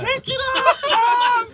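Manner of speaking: high, loud whooping shout; shrieked mock catcall with laughter; rowdy heckle. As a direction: holler it like a catcalling crowd. Heckling a shirtless friend.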